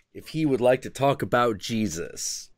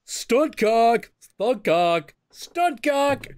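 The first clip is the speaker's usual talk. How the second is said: high yelled call, repeated; hammed-up high holler; mock crew shouting; 4 dB louder, much slower than usual. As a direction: shout it out like a crew summoning someone. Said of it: "it's just people going".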